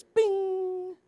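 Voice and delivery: high lightbulb bing; cartoon lightbulb ding; bing sound. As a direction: make the idea-pop noise. Light bulb sound effect.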